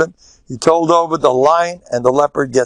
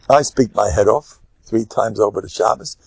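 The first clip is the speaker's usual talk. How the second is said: ordinary delivery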